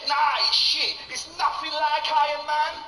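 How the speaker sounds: put-on high, loud dismissive rude boy; rude boy youth. Youth dismissing the film to his mate, loud rude boy. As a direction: scoff like a cocky teenager. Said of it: "It went a little bit like this".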